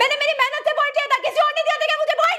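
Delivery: indignant rapid retort; heated outburst. Real, not acted. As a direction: fire it back, rushing the words. Heated argument over nomination.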